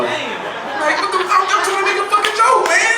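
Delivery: high, loud exasperated whine; high-pitched outrage. Retold or imitated. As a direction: complain way up high, offended. Outraged at girlfriend's warning.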